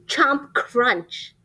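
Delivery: ordinary delivery, nothing marked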